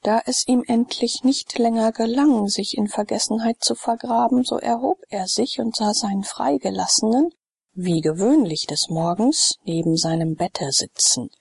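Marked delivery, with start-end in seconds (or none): none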